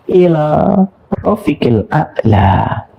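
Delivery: slow, faint Arabic farewell; dying words. Retold or imitated. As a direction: draw out the last words like a dying man. Prophet's dying words quoted.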